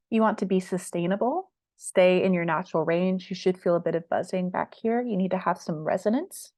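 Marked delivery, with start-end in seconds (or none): none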